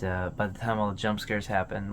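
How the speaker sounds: normal talking voice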